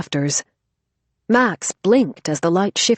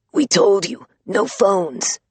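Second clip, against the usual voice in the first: lightly rasped fake deep voice; a hint of gravelly Batman; 3 semitones higher, slower than usual. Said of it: "pitched her voice deep, giving it a harsh rasp".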